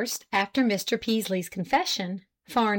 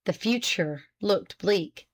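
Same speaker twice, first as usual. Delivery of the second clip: ordinary delivery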